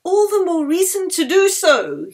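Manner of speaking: high, loud, cheerful retort; smiling reply. Acted. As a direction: answer with a smile. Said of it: "Yeli smiled".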